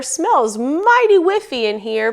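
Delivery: high, slow mama bear voice; drawn-out, high-pitched mother bear remark. A narrator's reading. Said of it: "said the mama bear".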